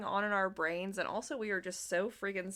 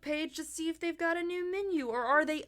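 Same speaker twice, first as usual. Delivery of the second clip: much higher, louder, breezy mock voice; airy mock chirp; 8 semitones higher, 4 dB louder than usual. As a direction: parody a cheerful casual search. Mock airy search for restaurant.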